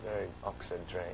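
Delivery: soft Oxford-trained British accent; very upper British accent; Oxford English accent. Shown as a demonstration. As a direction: show off an upper-class Oxford accent quietly. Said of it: "No, no, no, very upper".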